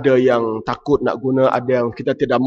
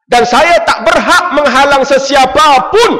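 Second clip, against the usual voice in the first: much higher, louder, laughing shout; preaching shout; 12 semitones higher, 7 dB louder than usual. Real, not acted. Preacher crying out to the congregation, loud. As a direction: proclaim it at full force with a laugh.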